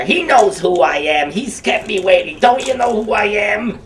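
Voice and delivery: high, loud put-on puppet voice, indignant; Humpty puppet scolding a kid, dopey Clinton-esque voice; dopey Humpty Dumpty voice. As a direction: play the dopey egg, offended at being kept waiting. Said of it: "that's his voice".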